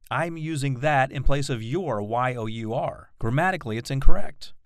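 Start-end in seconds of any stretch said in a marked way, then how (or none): none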